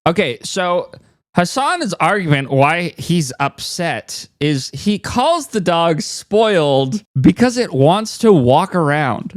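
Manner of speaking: ordinary talk, nothing marked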